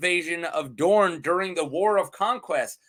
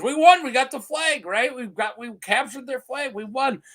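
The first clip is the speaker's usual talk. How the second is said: high, giddy mock triumph; gloating; 6 semitones higher than usual. Targaryen soldiers gloating at empty Sunspear, giddy. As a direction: crow it like kids capturing a flag.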